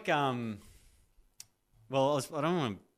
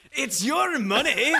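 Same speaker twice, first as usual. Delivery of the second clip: a laughing stab at Billy Connolly, higher; laughing attempt; 9 dB louder, slower than usual. Billy Connolly impression to the interviewer, laughing. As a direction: give up on the Scottish and crack up. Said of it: "Billy Connolly?".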